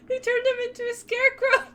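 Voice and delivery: very high, squeaky with laughter; helpless laughter. Real, not acted. Mocking the picture to friends, helplessly amused. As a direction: squeal it, barely holding the laugh.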